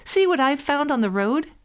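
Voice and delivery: high, bright shouting; shouted. A narrator's reading. Hans shows his brothers the dead crow, shouting. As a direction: call out the find proudly. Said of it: "shouted Hans Clodhopper".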